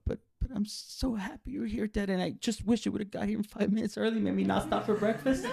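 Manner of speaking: put-on high-pitched child talking to dad; gush, then gently complain, voice held high; small, high voice of a relieved kid; happy but pleading.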